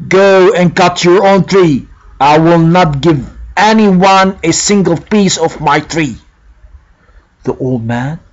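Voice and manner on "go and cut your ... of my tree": louder, harsh and rude refusal. A narrator's reading. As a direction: snap at him, dismissive. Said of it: "in an offensive tone".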